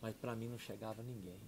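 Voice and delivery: ordinary talk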